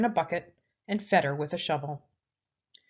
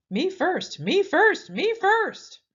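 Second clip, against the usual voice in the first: climbing higher and louder each time, mocking the pig; the creature mimicking the pig's cries; mocking imitation; 12 semitones higher, 7 dB louder, slower than usual. A narrator's reading. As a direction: parrot his cry back, building up. Creature mocks Pinkerton's cry.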